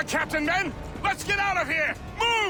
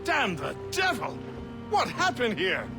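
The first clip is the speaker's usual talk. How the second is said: high-pitched cry of dismay; shocked exclamation; 8 semitones higher than usual. Acted. Sailor coming upon what happened here, shocked exclamation. Sailor finds scene of devastation.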